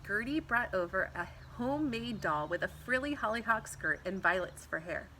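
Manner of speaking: usual speaking voice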